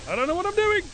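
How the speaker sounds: high, loud panicked wail; panicked shout. Real, not acted. Gamer overwhelmed by zombies, shouting in high panic. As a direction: cry it out in real panic.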